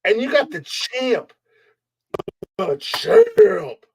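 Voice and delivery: high, slow ring announcer hype; wrestling announcer. Acted. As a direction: drag out the champ's intro.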